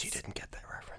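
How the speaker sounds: breathy stage-whisper aside. Real, not acted. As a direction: whisper it behind her back.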